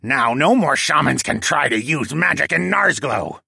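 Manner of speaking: cackle, then high, loud squawky boast; gloating penguin villain. A narrator's reading.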